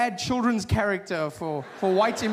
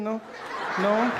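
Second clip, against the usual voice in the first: ordinary talk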